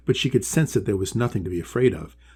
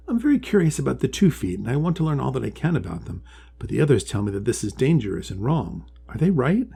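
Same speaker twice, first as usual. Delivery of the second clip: higher, eager little turtle voice; curious young Polly; 4 semitones higher than usual. Acted. Polly asking the wise one about the two feet, high and curious. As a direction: ask it wide-eyed and hopeful.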